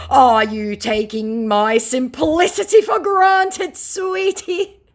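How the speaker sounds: slow, high, loud put-on indignation; mock scammer. Scammer challenging the target's insults, hammed-up indignation. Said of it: "said the scammer".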